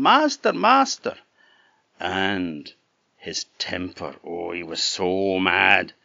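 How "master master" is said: much higher, loud cry of alarm; harp shouting. A narrator's reading. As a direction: call out high and loud to wake the master. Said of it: "the harp shouted out again".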